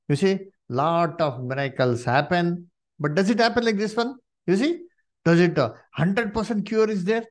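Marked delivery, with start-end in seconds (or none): none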